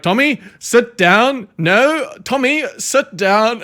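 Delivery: loud, high, slow South African scolding; teacher scolding Tommy in a South African accent. Retold or imitated. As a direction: tell the kid off like a fed-up South African teacher. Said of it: "the South African teacher being like".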